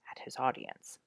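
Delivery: plain reading voice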